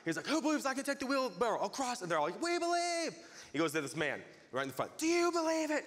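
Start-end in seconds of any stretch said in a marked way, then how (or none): 0.3-2.0 high-pitched showman's challenge; calling out. Retold or imitated. Tightrope walker challenging the crowd, calling out. 2.4-3.1 high mock cheer; crowd cheer. Crowd all answering the walker, cheering. 5.0-5.8 high, rousing acted challenge; calling out. Walker to the man right in front, calling out.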